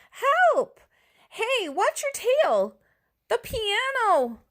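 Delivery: high, panicked shouts; panicked cries. Acted. Chaos in studio.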